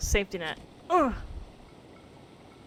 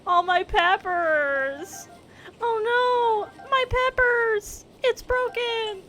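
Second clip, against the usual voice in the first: much higher, slow wail over the peppers; hammed-up lament for lost peppers; slow, high mock grief over broken peppers; 12 semitones higher, 5 dB louder, much slower than usual. Real, not acted. Loses her peppers in game.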